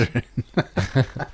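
slow, laughing mock Irish "Inisherin"; can't stop giggling through the name. Real, not acted. Host laughs while saying the place name in mock Irish. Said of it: "I can't stop".

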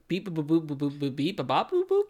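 beeping like a toy robot. Pretend. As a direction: chirp the beeps like a little robot. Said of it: "is he doing a little bit of".

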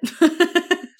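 a sudden high, loud burst of laughter; rueful laugh. Real, not acted. Laughing at herself over losing her grandma, a rueful burst. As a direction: laugh at how unprepared she was.

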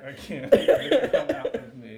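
mock echo, cracking up; laughing. Real, not acted. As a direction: laugh while echoing her line. Mock-repeats friend's line, laughing.

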